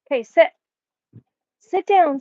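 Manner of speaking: loud, firm order to sit; loud command. Real, not acted. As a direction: raise your voice so the dog sits.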